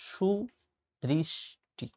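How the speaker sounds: সুদৃষ্টি split by long pauses, with sh sounds; syllable-by-syllable pronunciation. Shown as a demonstration. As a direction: say সুদৃষ্টি very slowly and softly, shu... drish... ti. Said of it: "সুদৃষ্টি শব্দের প্রমিত উচ্চারণ".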